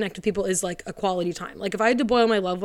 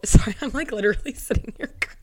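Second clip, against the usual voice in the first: real tearful; 3 semitones higher, faster than usual. Real, not acted. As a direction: voice breaking into a teary laugh. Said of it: "like, tearing up crying".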